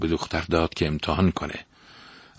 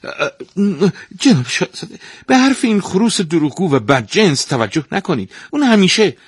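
high, louder, stammering in a panic; flustered stepmother; 12 semitones higher, 7 dB louder than usual. Acted. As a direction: scramble to discredit the rooster. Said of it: "با دست پاچگی گفت".

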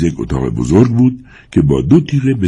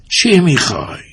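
put-on gruff old man in a high-pitched voice; high, harsh old man; 12 semitones higher than usual. A narrator's reading. Old man confronts the stranger at his door, high and harsh. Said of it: "پیرمرد با صدای زیر و خشن پرسید".

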